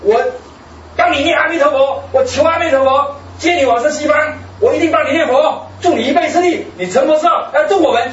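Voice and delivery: imitated loud, high-pitched pledge to his mother; loud vow. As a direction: proclaim it like a son kneeling before his dying mother. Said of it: "大声就说".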